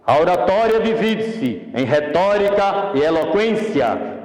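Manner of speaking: theatrical pathetic tone, projected to a crowd. Shown as a demonstration. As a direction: declaim it like an open-air stage actor. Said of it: "É um tom de voz alto".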